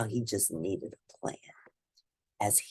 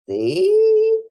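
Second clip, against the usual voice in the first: very high, loud "See"; emphatic aside; 11 dB louder than usual. Real, not acted. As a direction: grab attention for the moral. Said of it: "narrator breaks to moral".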